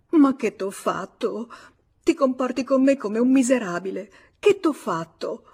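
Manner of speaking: wounded, insistent questioning; anguished pleading. Acted. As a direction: plead as the wronged lover. Abandoned lover pleads, clinging to him.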